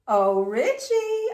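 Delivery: slow, sweet coo. A narrator's reading. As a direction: coax him sweetly, slowly. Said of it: "I cooed".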